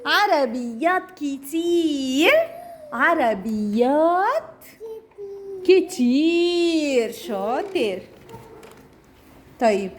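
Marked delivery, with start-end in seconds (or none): 0.1-7.1 slow, exaggerated teaching voice; slow drawn out. Shown as a demonstration. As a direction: stretch each syllable slowly for a toddler. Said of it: "modelling the plural".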